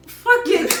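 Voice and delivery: high, loud burst of not caring; defiant outburst. Retold or imitated. Speaker recalling when she stopped caring about teasing, defiant outburst. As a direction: throw it off loudly, pitch jumping up. Retold moment of no longer caring.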